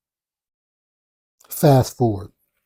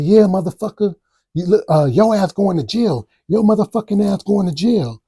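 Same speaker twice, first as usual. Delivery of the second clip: imitating her yelling, much higher and louder; yelling threat; 7 semitones higher, 3 dB louder than usual. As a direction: shout the jail threat like she did. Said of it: "She said".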